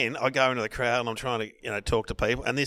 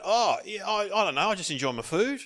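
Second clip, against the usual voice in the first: higher, sheepish self-quote; put-out reply, pitched up; 8 semitones higher than usual. Retold or imitated. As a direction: retell a wounded, lame comeback. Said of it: "I was so, um, put out".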